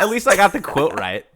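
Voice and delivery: high, loud, half-laughing defense; defensive and excited. Real, not acted. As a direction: insist through laughter. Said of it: "higher pitch".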